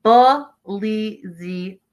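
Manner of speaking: "Belizean" very slowly as buh, LEE, ZEE, un; syllable by syllable. Shown as a demonstration. Host finally getting it, "Belizean" sounded out syllable by syllable. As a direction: break "Belizean" into four separate, stretched syllables. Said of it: "I got it, like, the tenth time".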